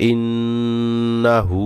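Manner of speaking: ইন্নাহু chanted very slow; drawn recitation. Shown as a demonstration. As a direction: pull out ইন্নাহু long. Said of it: "এখানে টান হবে".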